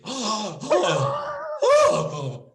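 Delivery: loud high mock-horrified gasp; hammed-up shocked gasp; dramatic gasp. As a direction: gasp as if scandalised.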